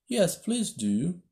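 high, slow eager pleading. A narrator's reading. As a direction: plead sweetly and slowly. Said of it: "pleaded Alice".

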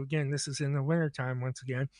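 ordinary delivery

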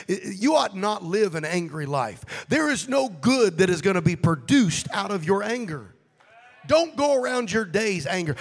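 loud, rising preacher's cry; preaching at full force. Acted. Preacher urging the congregation against anger, full force.

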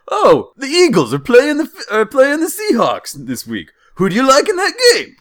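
high, loud cartoon Jersey accent. Driver quizzes passengers on the game, hammed-up Jersey accent. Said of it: "this dude is obviously from Jersey".

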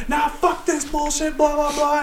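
high, strained mock grumbling; retold rant. Boss dismissing worker's taunts, high mock rant. Boss rants back in the row.

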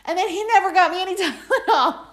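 giggly playful complaint; laughing tease. Real, not acted. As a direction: rib him while laughing. Laughing, teasing husband's forgetfulness.

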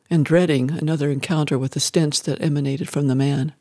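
plain reading voice

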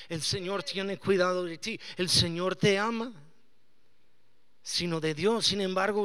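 usual speaking voice

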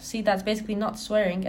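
ordinary delivery

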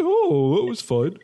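high, dopey singsong; oblivious Higgins. Retold or imitated. Host mocks oblivious Higgins in a high dopey voice. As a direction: wander happily with no clue. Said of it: "He was kinda like".

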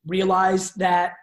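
drawn out slow, with weight on "Realize"; slow emphatic. Real, not acted. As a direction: stretch "Realize that" slowly to drive it home. Repeats the phrase, slowed for emphasis.

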